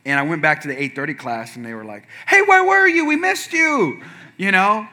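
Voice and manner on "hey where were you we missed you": put-on high-pitched greeting of old ladies; fussing older ladies. Older gym ladies welcoming him back, high doting voice. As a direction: imitate doting grandmothers in a high voice. Voicing elderly ladies at the class.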